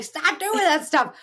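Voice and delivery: put-on high, slow exasperated mom scolding her kid; exasperated parent scolding. Mom imitating herself scolding her kids, high and exasperated.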